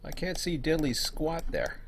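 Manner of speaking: usual speaking voice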